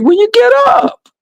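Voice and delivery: high, squawked mock exasperation; exasperated retort. Host answers her overnight guest, mock exasperated. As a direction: fire it back as if obvious.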